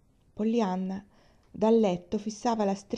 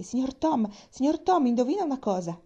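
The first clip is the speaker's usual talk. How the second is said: much higher and louder, eager and out of breath; breathless excitement; 7 semitones higher, 5 dB louder than usual. A narrator's reading. Nancy running to Tom with big news: breathless, high, loud. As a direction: call out to Tom, bursting with news. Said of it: "disse ansimando".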